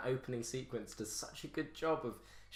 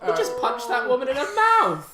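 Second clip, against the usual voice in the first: high, slow, loud disbelief; shocked shout; 11 semitones higher, 16 dB louder, slower than usual. Real, not acted. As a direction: yell it in shock.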